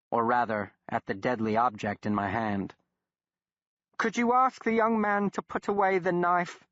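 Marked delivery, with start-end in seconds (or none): 4.0-6.7 lightly voiced, higher English gentleman, uneasy; nervous Englishman. A narrator's reading. Blackwood asking the doctor about the boy's knife, nervously. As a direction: ask politely but a little scared.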